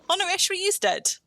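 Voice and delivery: jumps up high in alarm; a startled cry at the bad news; sudden alarm. Real, not acted. Teammate suddenly dies in game.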